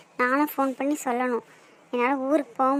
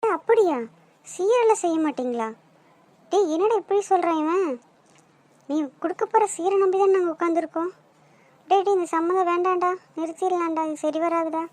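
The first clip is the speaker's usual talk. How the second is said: shocked, then calling it off; shocked outrage; 4 semitones higher than usual. Acted. Shocked no dowry, calls off. (அதிர்ச்சியுடன் கோபமாக)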